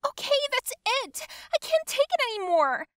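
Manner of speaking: very high, loud outburst; high-pitched, exasperated cry; fed-up yell. A narrator's reading. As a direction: yell out as if at breaking point. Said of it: "Mary yelled out".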